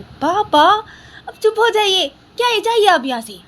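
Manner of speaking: very high, loud, exasperated; angry shout. Acted. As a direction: shout at father to shut up. Daughter shouts at teasing father. (पापा पर ऊँची आवाज़ में चिल्लाना)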